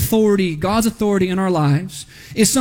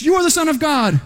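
high-pitched imitation of demons crying out; demons' outcry; 7 semitones higher than usual. Demons declaring who Jesus is, high outcry.

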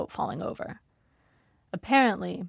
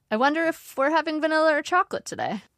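forced cheerful; 9 semitones higher, 4 dB louder than usual. Acted. Narrator changing the subject.

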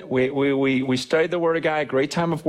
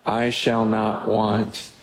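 slow, reverent reading of the psalm; scripture reading; 5 semitones lower, slower than usual. Acted. Pastor reads Psalm twenty-three aloud to the congregation, slow and reverent. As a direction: read each verse slowly, like a prayer.

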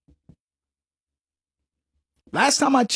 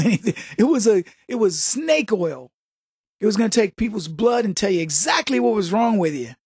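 ordinary talk

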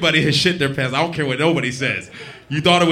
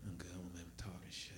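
much softer, quick, unvoiced whisper; inner voice whisper; 9 semitones lower, 27 dB quieter, faster than usual. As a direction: whisper your private thoughts fast. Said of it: "This is your mind talking".

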